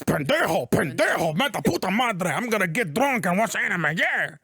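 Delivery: hammed-up Spanish-accented caricature; mock the character with a cartoonish Spanish accent. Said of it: "this is in stereotypes".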